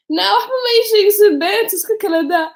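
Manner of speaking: high, loud retelling; retold phone reaction. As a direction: imitate an outburst on the phone.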